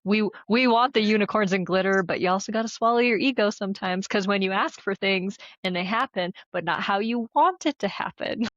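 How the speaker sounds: ordinary talk, nothing marked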